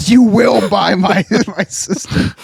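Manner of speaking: high, slow, laughing words; giggling it out. Real, not acted. Host laughing while telling about his sister.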